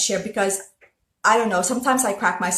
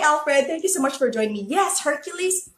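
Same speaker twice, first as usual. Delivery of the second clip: ordinary talk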